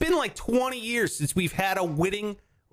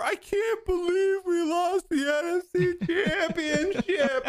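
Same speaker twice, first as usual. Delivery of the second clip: high, slow hammed-up sob through laughter; fake-blubber the loss, laughing as you do; mock crying; 10 semitones higher than usual. Mocking a future loss to his friend, hammed-up mock crying. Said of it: "crying on the floor".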